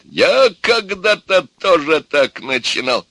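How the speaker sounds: loud, high, slow declaration; exclaiming. A narrator's reading. Grandfather recalling his own start, loud exclamation. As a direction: exclaim it with gusto. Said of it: "опять воскликнул дед".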